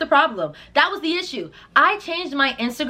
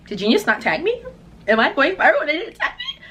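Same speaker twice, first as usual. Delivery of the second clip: panicked; 6 semitones higher than usual. Retold or imitated. Panicking over missing tag.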